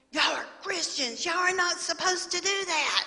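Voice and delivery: put-on high, loud child's scolding; a daughter bursting in to scold her parents. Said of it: "busting in and said".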